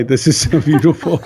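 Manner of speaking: giddy laughing repeat; laughing with joy. Retold or imitated. Retelling hugging the orphans, laughing with joy. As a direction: laugh through the words, delighted.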